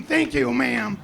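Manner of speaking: usual speaking voice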